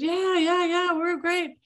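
lightly voiced upbeat parents; cheerful parents. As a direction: play the parents waving it off happily.

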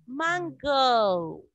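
Mango said the English way, syllables apart; teacher's clear demo of English "Mango"; English pronunciation. Shown as a demonstration. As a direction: model "Mango" as man-GOH with a long final o. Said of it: "pero se pronuncia así, miren".

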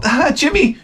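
high, gentle parent voice; soft parent. Retold or imitated. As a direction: coax the child lightly. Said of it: "the parents would turn around and go".

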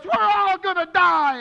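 loud, slow, high wail of panic; panicked cry. Retold or imitated. Mason panicking on the plane, loud slow high wail. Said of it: "And Mason goes".

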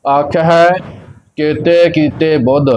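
ਆਖ ਹੈ, a long break, then the line; pause after ਆਖ ਹੈ. Shown as a demonstration. Teacher showing where to rest, pause after ਆਖ ਹੈ. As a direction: rest each time after ਆਖ ਹੈ. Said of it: "ਵਿਸ਼ਰਾਮ ਆਖ ਹੈ ਤੇ ਲੱਗੇਗਾ".

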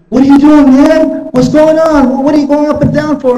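a hostile stranger shouting a question; retold yelling. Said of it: "kept yelling to me".

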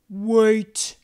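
a sudden loud wait from the bear; loud bear stop. Acted. Bear stopping his friends with a loud wait. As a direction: halt everyone at once.

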